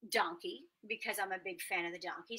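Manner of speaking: usual speaking voice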